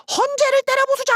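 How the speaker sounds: screaming high and loud, mocking a rally cry. Mocking a ruling-party lawmaker's call, screamed high. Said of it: "국민의힘 의원이야".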